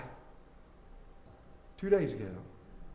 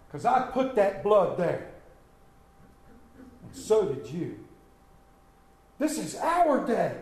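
ordinary talk

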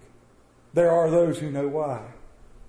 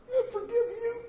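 much higher, half laughing, half crying; tearful forgiveness. Acted. Farmer forgives the neighbor at last, teary and laughing. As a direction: let the voice crack into a teary laugh.

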